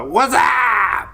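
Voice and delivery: high, loud "What's up?" call; imitating the Bud Light ad. Imitating the commercial's loud "What's up?" call to a buddy. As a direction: bellow it like the old beer ad. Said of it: "remember the old Bud Light commercial".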